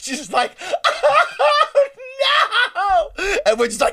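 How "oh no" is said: high mock wailing; hammed-up tearful howl; waterworks. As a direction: imitate bawling, drawn-out cry. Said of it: "the waterworks start".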